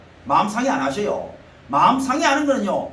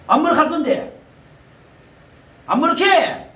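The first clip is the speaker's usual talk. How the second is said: higher, louder joyful exclaiming; exclaiming preacher; 5 semitones higher, 4 dB louder than usual. Acted. Preacher marveling to the congregation, excited exclaiming. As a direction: marvel aloud at God's knowing.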